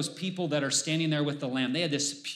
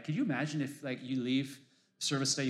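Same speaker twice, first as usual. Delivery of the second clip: ordinary delivery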